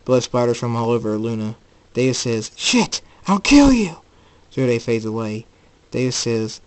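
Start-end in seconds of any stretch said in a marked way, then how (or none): 2.6-4.0 high, loud threat; furious shout. Acted. David seeing Luna wounded, furious shout. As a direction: burst out in rage.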